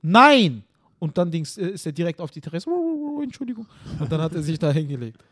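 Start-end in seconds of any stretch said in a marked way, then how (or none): 0.0-0.6 loud, high yell from the window; shouted command. Retold or imitated. 3.2-3.7 high, meek sorry voice; dog voiced apologising, high and meek; dog's apology.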